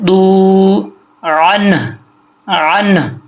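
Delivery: दू held long, then अन ending in a breathed h; final हा sound. Shown as a demonstration. Teacher models the last हा, drawn-out recitation. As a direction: hold दू, release an audible h after अन. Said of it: "last पे हा का sound आ रहा है".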